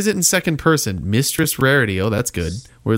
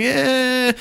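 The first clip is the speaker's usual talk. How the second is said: much higher, squeaky mock voice; mock inner voice; 10 semitones higher than usual. Retelling his own thought in a squeaky high voice. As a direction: pitch it way up like a silly inner voice. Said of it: "And I was just like".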